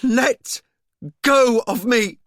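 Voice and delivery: loud, high, ringing order; authoritative shout. A narrator's reading. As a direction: cut through the noise with authority. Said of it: "Amber's voice rang with authority".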